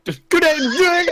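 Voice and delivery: high, slow, laughing through the words; can barely get it out for laughing. Real, not acted. Player laughing through reply.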